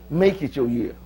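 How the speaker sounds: weighty preacher emphasis, MAKE leading; preacher talk. Acted. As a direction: drive home "Make it your year" like a preacher. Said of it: "I don't know no preacher talk like that".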